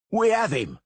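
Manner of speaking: high, loud shout. A narrator's reading. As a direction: shout in triumph. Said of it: "the masons shouted".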